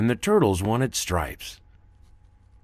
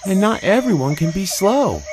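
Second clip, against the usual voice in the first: much higher, louder put-on tiger voice; 12 semitones higher, 6 dB louder than usual. Tigus answering Zeeby in his high tiger voice. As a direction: voice the young tiger high and bright. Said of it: "Tigus character voice".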